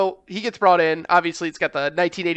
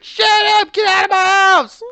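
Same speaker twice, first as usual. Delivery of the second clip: much higher and louder hammed-up shriek; mock screaming wife; 14 semitones higher, 7 dB louder than usual. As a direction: scream him out of the house. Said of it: "She's like".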